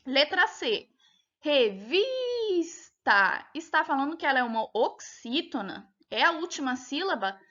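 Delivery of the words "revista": "revista" very slow, higher, with a long drawn-out vis; stress on VIS. Shown as a demonstration. Teacher reading option C to pupils, "revista" with a long stressed vis. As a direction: stretch the middle syllable of "revista". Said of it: "percebe que é o vis".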